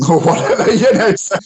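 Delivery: high, loud laughing you know. Real, not acted. Teacher laughs at her own product owner joke, laughing.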